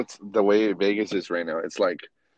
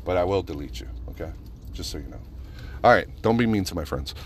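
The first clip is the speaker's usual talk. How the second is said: ordinary delivery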